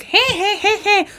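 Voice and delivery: high, drawn-out "hey" cries; mock howling. She acts out clutching her toes, high mock howling. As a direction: wail it comically high. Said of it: "holding, like, fucking grommet".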